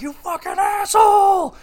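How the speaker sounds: big high furious shout; mock angry yell. Game character yelling at his rival, hammed-up shout. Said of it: "He's like".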